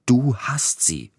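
slow, high-pitched accusation; stubborn insistence. A narrator's reading. Feverish patient accuses servant over his watch, stubbornly. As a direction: drag out the accusation, refusing to budge. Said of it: "behauptete er hartnäckig".

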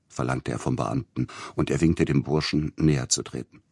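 ordinary delivery, nothing marked

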